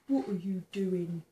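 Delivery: usual speaking voice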